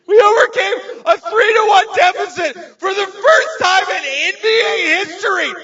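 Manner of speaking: very high, loud, hammed-up sobbing shout; tearful yelling. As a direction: scream it through mock tears. Acted bawling argument with his wife.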